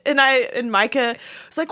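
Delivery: ordinary talk, nothing marked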